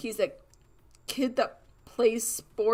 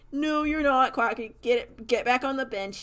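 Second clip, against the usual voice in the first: mock scolding; 5 semitones higher, 5 dB louder than usual. Everyone scolds the benched player.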